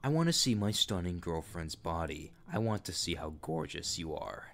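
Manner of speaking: put-on a sleazy groomer coaxing a girl; creepy older man voice. Groomer messaging a young girl, low creepy voice.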